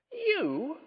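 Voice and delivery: high-pitched shocked gasp; gasping disbelief. A narrator's reading. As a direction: gasp the word in outrage. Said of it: "gasped the ghost".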